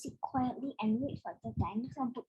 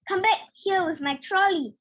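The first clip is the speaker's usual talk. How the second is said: loud, higher angry call; shouting volunteer; 7 semitones higher, 12 dB louder than usual. A narrator's reading. Volunteer chasing the trolley, shouting loudly. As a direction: call after him to bring it back. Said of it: "volunteer shouted".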